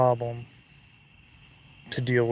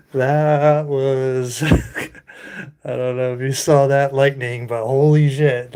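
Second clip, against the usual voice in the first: louder, amazed and laughing; shocked; 8 dB louder than usual. Real, not acted. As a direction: blurt out astonishment. Shocked by lightning.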